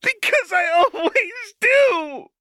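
put-on high, loud childish scream. A narrator's reading. Powder screaming at Silco, high and loud. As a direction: shriek it out in despair. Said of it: "she screamed".

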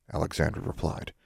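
usual speaking voice

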